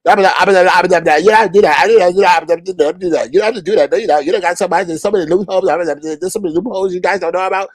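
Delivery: sputtering, rapid-fire cartoon babble; Daffy Duck. Host mimics a panelist's endless excuses, mocking cartoon babble. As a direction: run the words together in a mocking jabber. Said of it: "she sounded like Daffy Duck".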